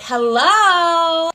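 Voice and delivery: drawn-out hello, pitched high and loud; big, high, loud mock-friendly hello; exaggerated greeting. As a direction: call out an over-the-top hello. Acted greeting to mom's boyfriend.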